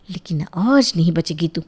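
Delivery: low, soft menacing warning; quiet threat. Acted. Mother warning Jiya she won't be saved, quiet threat. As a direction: drop the voice and threaten.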